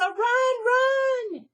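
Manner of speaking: very high, loud cry to run; urgent shout. Acted. Melissa shouting at Annie to run from the cat. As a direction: scream at her friend to flee.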